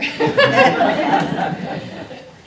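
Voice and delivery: a burst of breathy real laughter; laughing. Real, not acted. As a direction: laugh at yourself, unvoiced and high. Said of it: "I feel like I just talked like an auctioneer".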